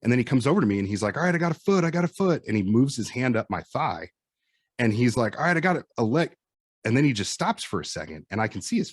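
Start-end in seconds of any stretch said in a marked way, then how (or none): 1.2-2.4 higher excited callout. Retold or imitated. Retold rescuer calling out. 5.4-6.3 higher excited callout. Retold or imitated. Retold rescuer calling out.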